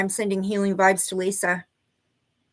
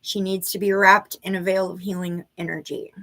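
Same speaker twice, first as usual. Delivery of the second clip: ordinary talk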